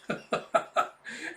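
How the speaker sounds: short, quick chuckle; real laugh. Real, not acted. Host amused by the cheer, laughing. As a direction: laugh at your own impression.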